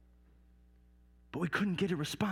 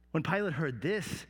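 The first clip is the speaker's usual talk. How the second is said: ordinary delivery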